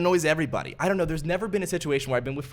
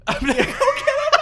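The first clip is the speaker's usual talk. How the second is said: laughing imitation; 6 dB louder than usual.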